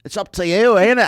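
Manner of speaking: loud, high Scottish brogue of Gary John Bishop. Retold or imitated. Friend mimics Gary John Bishop for a laughing co-host, in a loud Scottish brogue. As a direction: belt it out in a hammed-up Scottish brogue. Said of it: "that Scottish brogue".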